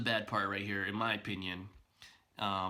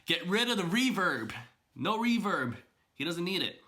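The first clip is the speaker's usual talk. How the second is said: raised, pleading emphasis; emphatic; 6 semitones higher, 3 dB louder than usual. Real, not acted. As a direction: push the point hard. Emphatic plea, louder and higher.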